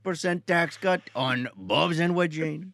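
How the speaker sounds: put-on Trump cadence in an Indian accent; Indian Trump impression. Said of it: "Indian Trump impressions".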